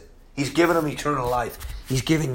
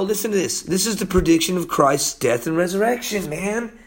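usual speaking voice

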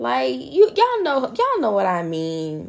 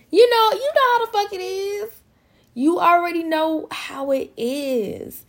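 much higher, knowing exasperation; exasperated; 10 semitones higher than usual. Real, not acted. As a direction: throw it at the listeners like they already know. Exasperated aside to listeners.

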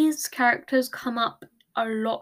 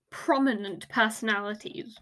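ordinary delivery

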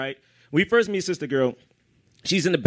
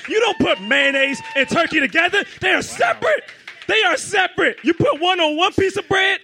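heated shout; 10 semitones higher than usual. Real, not acted. Scolding his friend about sandwiches, shouting. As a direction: shout it like it's obvious.

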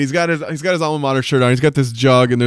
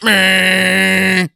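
disgusted grunt; 6 semitones higher, 5 dB louder than usual. Retold or imitated. Bob tasting the bad drink.

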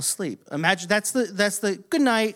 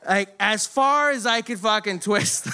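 ordinary delivery

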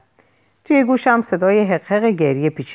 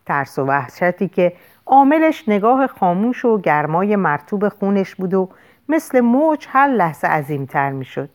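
ordinary delivery

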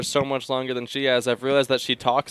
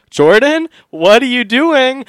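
much higher, loud and slow; alarmed call; 11 semitones higher, 12 dB louder, slower than usual. Retold or imitated. To his girlfriend acting strangely in her sleep, a loud alarmed call.

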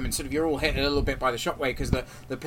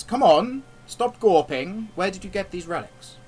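stern captor; 6 semitones higher, 6 dB louder than usual. Acted. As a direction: demand answers like a menacing captor. GM voices captor.

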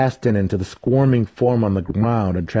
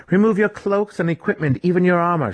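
much higher, firm orders; commanding cleric; 10 semitones higher than usual. A narrator's reading. Cleric ordering raiders to shed their gear, firm. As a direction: order the group to strip. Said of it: "she instructed the group".

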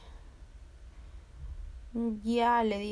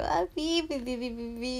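high mock whimpering; comic boo-hoo in little high bursts; imitated crying; 3 semitones higher than usual. Girl scolded by guards, imitating her own high-pitched crying. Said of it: "estaba llorando".

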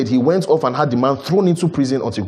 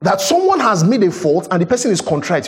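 usual speaking voice

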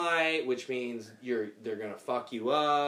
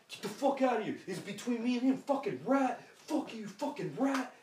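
angry gangster voice; 8 semitones higher than usual. Retold or imitated. Sean raging in fight.

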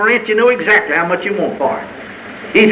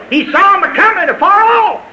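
high, ringing proclamation; fervent pulpit cry; exultant preaching cry; 11 semitones higher than usual. Real, not acted. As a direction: lift it high in triumph. Repeats the story's climax.

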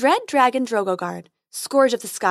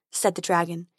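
usual speaking voice

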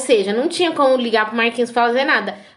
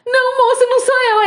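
high, loud frantic denial; retold panicked no; panicked high protest; 12 semitones higher, 4 dB louder than usual. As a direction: plead in a shrill panic, much higher and louder.

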